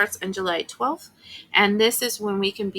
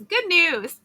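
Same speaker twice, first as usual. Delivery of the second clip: cheery announcement; 9 semitones higher, 4 dB louder than usual. Acted. Voicing God's humorous announcement.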